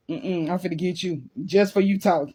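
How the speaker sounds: usual speaking voice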